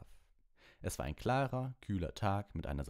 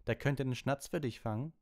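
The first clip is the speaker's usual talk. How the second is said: high, cheeky comeback; lightly voiced cocky Harry; Harry taunting; 8 semitones higher, 3 dB louder than usual. A narrator's reading. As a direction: toss the jab back with a grin. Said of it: "sagte Harry".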